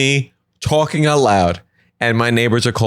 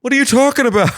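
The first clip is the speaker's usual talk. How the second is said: high, sweet husband voice, much higher; doting husband; 13 semitones higher than usual. Acted. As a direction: gush like an oblivious husband admiring the yard. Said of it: "He's like".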